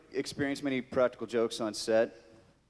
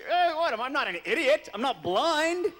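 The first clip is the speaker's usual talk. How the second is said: higher, louder, indignant protest; mock defensive; 11 semitones higher, 4 dB louder than usual. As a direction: play it offended. Mocking his own defensiveness.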